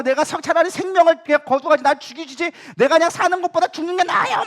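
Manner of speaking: high, loud petulant protest; Jonah. Acted. Jonah demanding that God take his life, confrontational. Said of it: "막 하면서 막 따지는 거죠".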